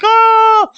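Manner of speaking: very high, loud drawn-out yell; Luffy's scream. Retold or imitated. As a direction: imitate a cartoon hero's zapped scream. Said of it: "Luffy was like".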